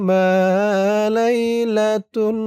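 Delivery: slow melodic chant; Quran recitation. Shown as a demonstration. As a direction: stretch each word like tilawah. Teacher recites the verse.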